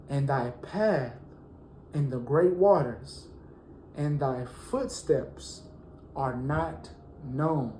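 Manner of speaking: slow, drawn-out scripture reading with long pauses. Shown as a demonstration. Preacher slowly reading a psalm to the congregation. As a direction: let each phrase sink in slowly. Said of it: "I'm gonna read it slow".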